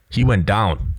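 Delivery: normal talking voice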